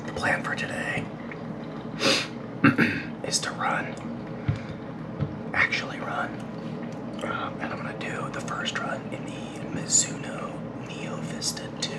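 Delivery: soft breathy whisper, not to wake anyone; hushed morning whisper. Real, not acted. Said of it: "I'm whispering".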